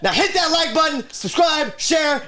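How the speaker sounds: high, loud hyped sign-off; wrestler's catchphrase shout. Pretend. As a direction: scream the catchphrase to the crowd.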